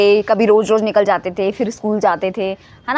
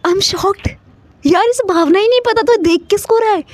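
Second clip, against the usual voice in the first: baffled outburst, pitched up; shocked disbelief; 8 semitones higher than usual. Retold or imitated. Retold shock, can't believe it.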